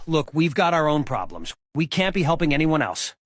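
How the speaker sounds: ordinary talk, nothing marked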